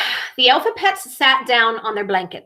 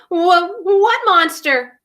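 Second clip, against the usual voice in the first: frightened stammer; 7 semitones higher, 4 dB louder, slower than usual. Acted. Fearful frog asks, stammering.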